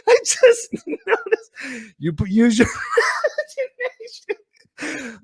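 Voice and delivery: high, squeaky laughter through the words; helpless laughing. Real, not acted. Host noticing his shirt, cracking up. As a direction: barely get it out for laughing.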